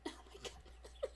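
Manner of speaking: breathless whispered oh my god; whispered exasperation. Real, not acted. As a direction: whisper it under your breath. Waiting on frozen computer.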